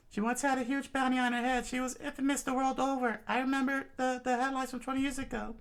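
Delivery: high, quick exposition voice; high fast telling. Acted. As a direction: rattle off the backstory, high. Soldier explains.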